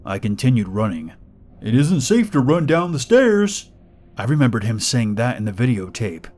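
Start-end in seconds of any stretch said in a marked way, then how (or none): 1.6-3.7 creepy pig man voice. Acted.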